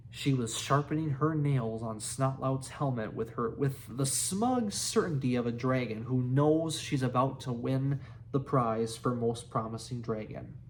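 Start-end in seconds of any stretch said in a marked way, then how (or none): none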